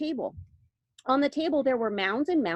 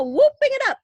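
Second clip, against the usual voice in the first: very high, loud whoop; gleeful; 10 dB louder, faster than usual. A narrator's reading. Wonka picturing the tipsy Oompa Loompas, squealing. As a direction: squeal it with delight. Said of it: "Listen, you can hear them".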